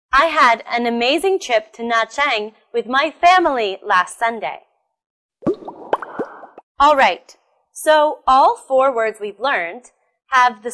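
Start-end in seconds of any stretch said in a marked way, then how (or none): none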